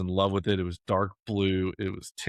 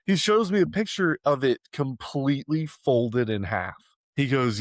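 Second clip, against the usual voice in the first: normal talking voice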